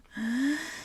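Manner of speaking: a deep breath drawn in before speaking; gathering courage with one breath. A narrator's reading. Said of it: "Franklin took a deep breath".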